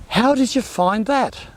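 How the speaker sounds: high, slow, wondering question; amazed question. Retold or imitated. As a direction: ask it in real amazement. His own amazed question quoted.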